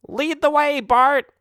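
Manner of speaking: squeaky eager bat; high, thin creature voice. Acted.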